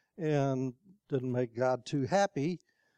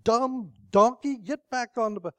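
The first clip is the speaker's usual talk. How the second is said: angry rider; 8 semitones higher, 4 dB louder than usual. Acted. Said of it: "starts beating the donkey".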